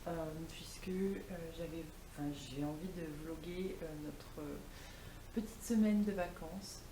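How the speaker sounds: vocal fry; low, croaky vocal fry; morning voice. Real, not acted. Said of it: "j'ai ma voix du matin".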